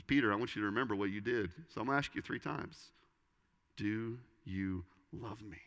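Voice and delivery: gentle soft question. Retold or imitated. Jesus asking Peter softly.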